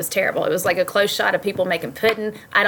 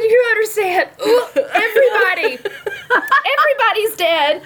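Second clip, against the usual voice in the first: high, upset, half laughing; frantic retold reply; 14 semitones higher, 4 dB louder than usual. Wife explaining the Red Wedding to her husband, high and upset. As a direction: squeal it like she's overwhelmed.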